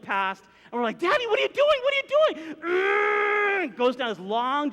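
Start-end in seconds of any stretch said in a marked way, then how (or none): 1.0-2.4 very high, loud frantic yelling; panicked children. Retold or imitated. Children panicking as dad guns van. 2.6-3.8 high, loud revving noise; imitated engine roar. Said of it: "gunned right past the gates".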